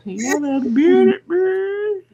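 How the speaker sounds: slow, high, loud through laughter; laughing. Real, not acted. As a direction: laugh while protesting. Laughs at being muted.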